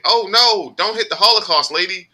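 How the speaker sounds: high, loud imitation of a kid; little girl's cry. Girl in back sees lady crossing the street, high mock alarm. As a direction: call it out high, mimicking a child's alarm. Child's shout voiced in story.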